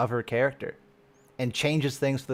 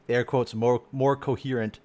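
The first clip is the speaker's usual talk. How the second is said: normal talking voice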